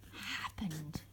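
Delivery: usual speaking voice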